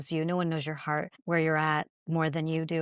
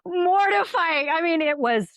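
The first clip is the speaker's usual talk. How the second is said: laughing embarrassed; 12 semitones higher, 5 dB louder than usual. Real, not acted. Recalling embarrassing audition.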